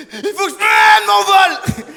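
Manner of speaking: high, loud bawling plea, hammed up; slow, stretched mock-sobbing shout; panicked crying. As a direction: wail it through exaggerated tears. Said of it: "quand je pleure, je panique".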